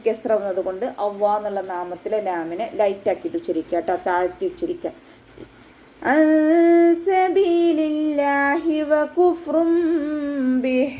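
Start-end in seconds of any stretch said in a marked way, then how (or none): 6.1-10.9 slow, drawn-out, higher chanted recitation; Quran recitation. Shown as a demonstration. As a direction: recite it slowly with tajweed. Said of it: "വാവ് ഉച്ചരിക്കുന്ന സമയത്ത്".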